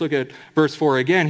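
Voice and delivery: ordinary talk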